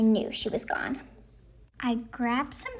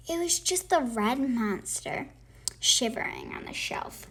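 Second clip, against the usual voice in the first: usual speaking voice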